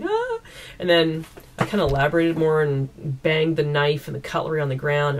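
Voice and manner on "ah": high, sharp imitated shriek; mock scream. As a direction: fake a cry of pain from a cut finger. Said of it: "she's like".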